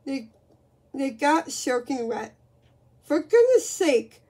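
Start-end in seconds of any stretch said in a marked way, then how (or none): 3.0-4.2 loud and high exasperated bellow; drenched wife bellowing. A narrator's reading. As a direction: bellow it at a clumsy boy. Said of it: "bellowed Missus Sigmund".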